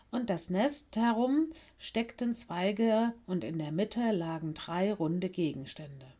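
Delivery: ordinary delivery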